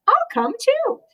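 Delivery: high squeaky rabbit voice; little rabbit voice. Acted. Rabbit tagging along with Moose, eager high squeak. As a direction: chirp it like an eager little bunny.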